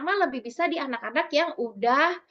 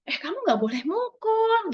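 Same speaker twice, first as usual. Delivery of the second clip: higher, sharp mother snapping a rule straight away; sharp scolding; 9 semitones higher than usual. Shown as a demonstration. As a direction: scold first, the wrong way. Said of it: "kalau dibalik".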